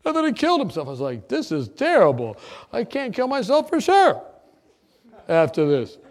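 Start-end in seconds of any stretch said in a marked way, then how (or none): 0.0-0.9 much higher, louder mock mother voice; mother at potluck. Mother telling potluck guests about her son, high mocking voice. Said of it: "and she's going". 1.3-6.0 louder, higher exclaiming; mock panic. Son in phone booth reacting to imagined potluck, loud mock alarm.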